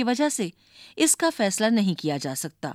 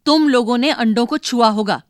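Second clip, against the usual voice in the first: louder, scolding mother; mother angrily accuses her children; angry accusation; 3 semitones higher, 7 dB louder than usual. A narrator's reading. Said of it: "गुस्से से बोली".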